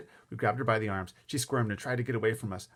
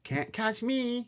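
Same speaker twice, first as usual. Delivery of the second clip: high, slow sing-song taunt; playful holler; 13 semitones higher, much slower than usual. A narrator's reading. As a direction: holler a teasing little-kid taunt, drawn out. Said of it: "he hollered".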